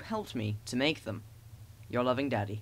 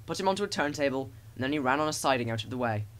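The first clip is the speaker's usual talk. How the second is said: plain reading voice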